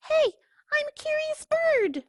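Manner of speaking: very high, sing-song duckling voice; sweetly innocent; curious duckling. Acted.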